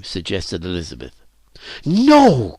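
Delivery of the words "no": high, loud, drawn-out cry. A narrator's reading. Phyllis rejects Elizabeth's suggestion, crying out. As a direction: cry it out in protest. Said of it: "cried Phyllis".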